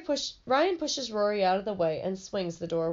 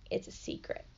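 whisper; 10 dB quieter than usual. Acted. Sister sharing gossip.

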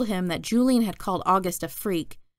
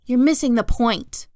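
exasperated mom, louder and higher; yelling; 5 semitones higher, 6 dB louder than usual. A narrator's reading. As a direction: moderate narrated yell. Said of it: "Mom yelled".

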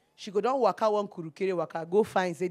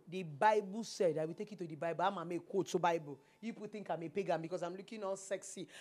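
ordinary talk

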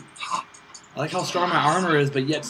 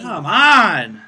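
loud, higher come on; exasperated shout; 5 semitones higher, 9 dB louder than usual. Real, not acted. Gamer shouting at teammates, loud exasperation. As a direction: yell at the game. Ally slain.

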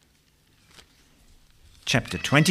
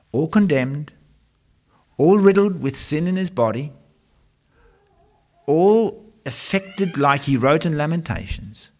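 higher, louder, faster preaching; preaching at full force; 5 semitones higher, 4 dB louder than usual. Acted. Preacher driving home Jesus' condemnation, forceful. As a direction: press the point home hard.

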